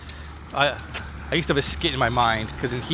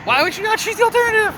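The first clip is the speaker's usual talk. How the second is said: high incredulous squeak; 4 dB louder than usual. Real, not acted. Host baffled by cohost's pronunciation, squeaky high disbelief. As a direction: question pitched way up in mock disbelief. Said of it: "'Cause it sounds French".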